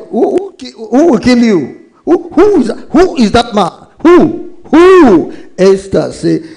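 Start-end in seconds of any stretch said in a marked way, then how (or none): 0.1-5.3 high, loud demanding king, "who?" repeated; outraged king. Acted. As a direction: ask like a furious king who can't believe it. King voiced demanding who threatens.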